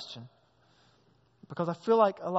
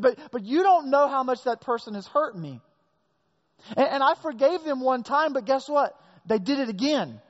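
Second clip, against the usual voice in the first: raised, pleading complaint of someone wronged; exasperated hurt listener; 7 semitones higher, 5 dB louder than usual. Pretend. Preacher voicing a hurt churchgoer, exasperated and raised. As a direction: whine that they keep hurting you.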